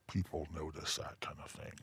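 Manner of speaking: customer's furtive aside, voice dropped right down; imitating a whisper. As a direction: lean in and confide it very softly. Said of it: "he whispers".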